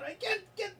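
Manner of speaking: put-on Scottish accent. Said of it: "can't get rid of this motherfucking accent".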